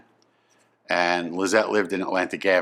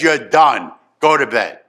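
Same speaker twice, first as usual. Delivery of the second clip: loud, final parental order; angry dismissal; 3 semitones higher, 7 dB louder than usual. Retold or imitated. Father sends son to bed, angry and final. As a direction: shut the argument down hard. Retold father ends the argument.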